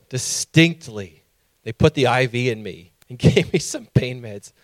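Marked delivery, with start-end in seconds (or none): none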